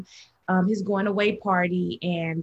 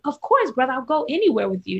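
eager placating yes, louder and higher; appeasing; 6 semitones higher, 4 dB louder, faster than usual. Retold or imitated. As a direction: humor him with a bright quick yes. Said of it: "just, like, appeasing him for the moment".

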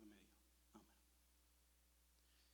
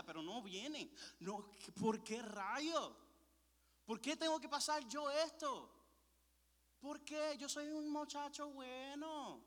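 exasperated plea building to a loud, high cry; anguished pleading; 6 semitones higher, 5 dB louder than usual. Retold or imitated. Young man protesting to God over his family, anguished and building.

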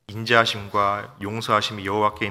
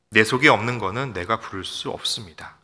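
ordinary delivery

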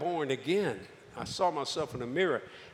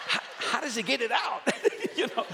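laughing wonder; 8 semitones higher than usual. Retold or imitated. Marvelling, laughing.

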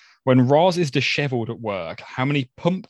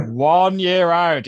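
Ken Bruce catchphrase; 7 semitones higher, 7 dB louder, slower than usual. Retold or imitated. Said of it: "As Ken Bruce would say on Popmaster".

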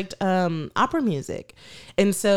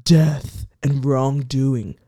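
Zaddy; 7 semitones lower, much slower than usual. Retold or imitated. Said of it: "He is hyping it up".

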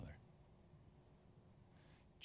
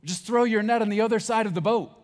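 loud, much higher shout across the water; called out from the shore; 16 dB louder than usual. Acted. Jesus calling from the shore to the boat, loud and high. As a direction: call out to fishermen far off. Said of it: "they hear the voice say".

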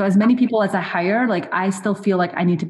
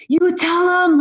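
cheering followers; 11 semitones higher, 3 dB louder, slower than usual. Retold or imitated. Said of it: "my followers were like".

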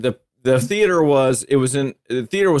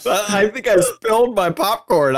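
ordinary talk

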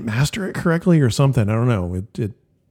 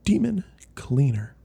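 breathy whispered title; 3 dB quieter than usual. Pretend.

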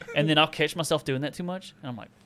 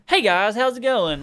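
high, loud, slow vlogger greeting; mock the upbeat YouTuber voice; imitating his own YouTube voice; YouTube accent; 8 semitones higher, 6 dB louder than usual. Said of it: "I have a YouTube accent".

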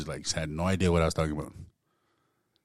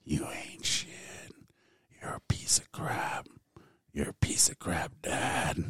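breathy voiceless whisper of insults; the snoring voice whispering insults to him; eerie whisper; 9 dB quieter, slower than usual. Retold or imitated. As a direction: hiss it like a haunting voice in the dark. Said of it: "that snoring turned into a voice".

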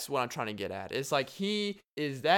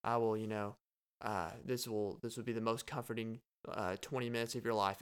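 a lightly voiced soft yoga instructor, lower and softer; gentle instructor voice, lightly imitated; soothing yoga teacher voice; 4 semitones lower, 7 dB quieter than usual. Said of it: "nice, soothing sounding voice".

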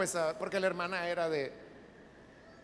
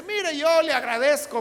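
emphatic thanks; 6 semitones higher, 9 dB louder than usual. Retold or imitated. Hija agradece en el funeral.

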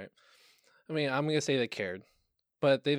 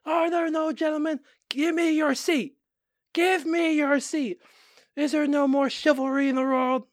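high, loud mock-shrieking woman; screaming lady; 13 semitones higher, 6 dB louder than usual. Mimicking the lady on the bus video, high and loud. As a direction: ham up an outraged lady demanding a seat. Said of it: "She's like".

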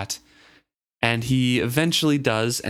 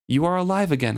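wonderingly; 7 semitones higher, faster than usual. Acted. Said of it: "I said wonderingly".

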